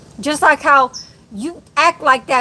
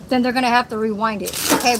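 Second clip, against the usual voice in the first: ordinary talk, nothing marked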